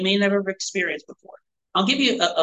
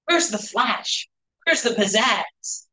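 slow, mocking disappointed scoff; dismissive skeptic; 4 semitones higher than usual. Pastor mocking people who find helps unspiritual, slow and scoffing. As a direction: sneer at how dull it is. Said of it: "Most people would look at this and go".

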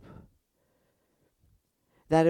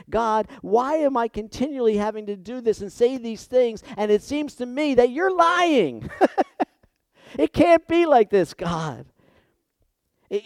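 loud, higher, pleading for Jeremiah; impassioned preaching; 8 semitones higher, 9 dB louder than usual. Acted. Pastor voicing Jeremiah accusing God, loud and pleading. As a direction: cry out as the prophet to God.

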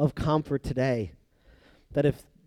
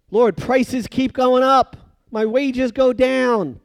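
mock complaining prayer; 8 semitones higher, 5 dB louder, slower than usual. Mock believer complaining to God.

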